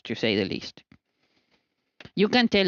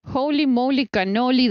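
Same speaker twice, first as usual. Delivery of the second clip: bright, raised burst of amazement; amazed; 6 semitones higher, 3 dB louder than usual. Real, not acted. To the camera over the huge meringues, amazed. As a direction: exclaim at how big they got.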